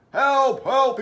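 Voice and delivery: storybook policeman shouting for help; radioed call for help. Acted. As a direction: call out urgently over the radio.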